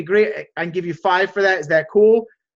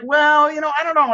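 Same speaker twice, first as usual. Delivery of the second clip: acted hesitation; 9 semitones higher, 4 dB louder than usual. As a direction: hem and haw in a higher voice. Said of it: "And you're like".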